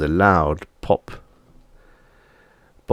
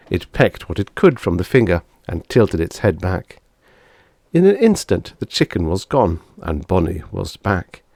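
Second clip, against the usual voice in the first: usual speaking voice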